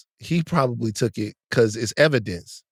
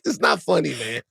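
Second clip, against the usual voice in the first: ordinary talk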